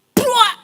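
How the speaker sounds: loud, high imitated thud; crash sound. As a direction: slam it out like a body hitting wood. Woman crashing into pulpit. (bunyi jatuh keras)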